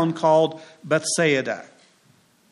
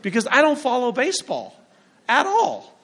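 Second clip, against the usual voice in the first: rising mock panic; 7 semitones higher than usual. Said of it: "I thought to myself".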